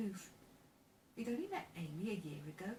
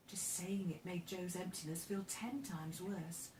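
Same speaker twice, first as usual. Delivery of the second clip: usual speaking voice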